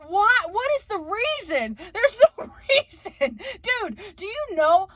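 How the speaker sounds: high, exasperated questions; worked up and demanding a reason; incredulous rant. Real, not acted. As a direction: pitch it up in disbelief. Ranting about the accent trope.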